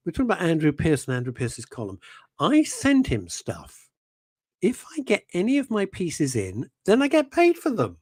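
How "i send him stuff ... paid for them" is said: slow mocking read, rising higher; mocking quote. Reading a viewer's message in a mocking voice. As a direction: read his words back like a joke. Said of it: "what is Graham Pack saying now".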